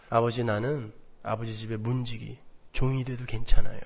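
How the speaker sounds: bow your head and ask; humble plea. Retold or imitated. Younger son returning to his father, soft humble plea.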